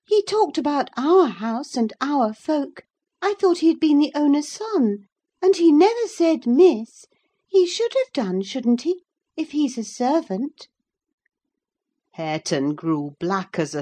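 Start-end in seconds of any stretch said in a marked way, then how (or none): 0.0-10.7 put-on high, loud, childish complaint; tiresome girl, puzzled. Childish speech to the housekeeper about the lad, high and pestering. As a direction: prattle indignantly in a high girl's voice.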